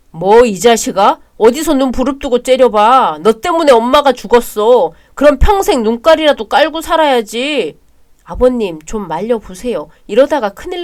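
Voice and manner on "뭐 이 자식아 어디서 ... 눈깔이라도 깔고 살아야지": furious shouting. Acted. Brothers' heated quarrel over mother.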